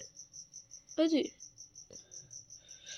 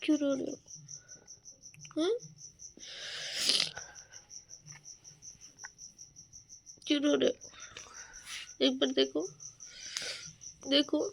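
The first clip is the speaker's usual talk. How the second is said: gentle coaxing; 9 dB quieter than usual. Real, not acted. Wife softly coaxing her crying husband to look at her. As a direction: coax him softly to stop crying.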